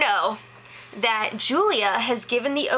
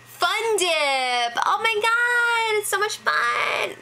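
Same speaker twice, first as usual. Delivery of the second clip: high, drawn-out fake glee; mock excitement; 10 semitones higher, much slower than usual. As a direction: gush over the prize with hammed-up delight. Mock glee over disappointing candy prize.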